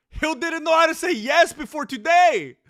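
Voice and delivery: high loud hype shout; excited shout. Real, not acted. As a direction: yell it at the screen. Hyped shouting at fight.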